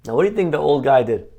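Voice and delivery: ordinary delivery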